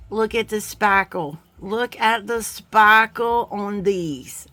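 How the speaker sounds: badly imitated Boston accent; Marianne. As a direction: put on a bad Boston accent, drawing out "sparkle". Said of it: "really bad impersonation of Marianne".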